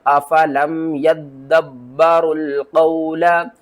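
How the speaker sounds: slow chant, doubled d and b in "यदब्बरुल"; correct recitation. Shown as a demonstration. Teacher recites the correct way to students, slow chant. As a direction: recite slowly, pressing the doubled letters. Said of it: "सही पढ़ने का तरीका क्या है?".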